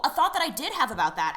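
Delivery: usual speaking voice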